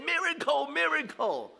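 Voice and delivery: high, tearful cry of miracle; a mother's overjoyed tearful exclaim, pitched high; quoting the mother crying out with joy, high. Retold or imitated. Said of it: "crying, and said".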